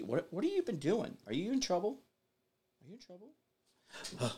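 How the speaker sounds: high, soft sing-song to a dog; pet talk. Real, not acted. Asking his dog if she is in trouble, soft high baby talk.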